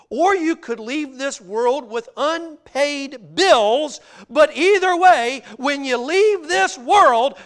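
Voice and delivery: loud, high, slow preacher's delivery; emphatic preaching. Real, not acted. Preacher tells congregation money stays behind, emphatic. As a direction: drive each phrase home from the pulpit.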